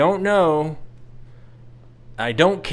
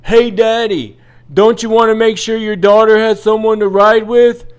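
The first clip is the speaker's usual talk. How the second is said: loud, higher taunting call from the crowd; yelling Karen; 6 semitones higher, 9 dB louder than usual. Retold or imitated. Karen in the crowd heckles him, loud and high. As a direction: shout it across the line like a nosy Karen. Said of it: "this Karen from the crowd yells".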